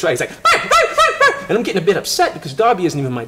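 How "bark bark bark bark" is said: very high, loud little-dog yaps; high yappy barks. Retold or imitated. Teller imitating nervous little Darby, high yapping barks. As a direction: yap like a nervous tiny dog. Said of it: "He's real nervous and high-pitched".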